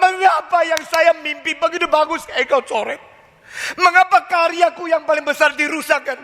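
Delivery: a believer accusing God over a ruined dream; aggrieved protest. Acted. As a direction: cry out at God, wounded and indignant.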